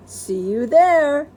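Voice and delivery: lady's sing-song goodbye; slow high Mrs Rogers. Acted. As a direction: voice the neighbour lady high and slow. Mrs Rogers voiced in story.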